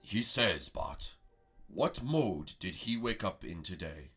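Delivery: low, slow mystic master asking a riddle; wise master voice. Acted. Master Prego asks riddle.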